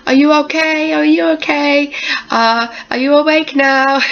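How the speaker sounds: high, concerned calls; fussing staff. Retold or imitated. Staff checking on a waking patient, high and concerned. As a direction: call out gently as if checking on someone waking. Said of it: "I had everybody going".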